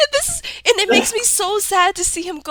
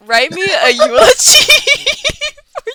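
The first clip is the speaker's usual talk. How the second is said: laughing; 8 semitones higher, 7 dB louder than usual. Retold or imitated. Quoting own ChatGPT request, laughing.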